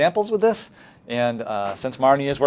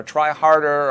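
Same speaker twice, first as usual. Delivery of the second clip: a louder, pushy try harder; mock teacher nudge; 4 semitones higher, 6 dB louder, slower than usual. Shown as a demonstration. Teacher pressing a student after a mistake, pushy. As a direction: push the student a bit.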